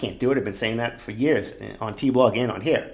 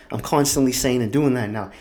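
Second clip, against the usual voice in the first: ordinary talk, nothing marked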